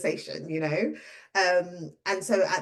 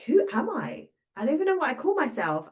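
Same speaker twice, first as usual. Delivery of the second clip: bewildered; 7 semitones higher than usual. Retold or imitated. Retells her identity crisis.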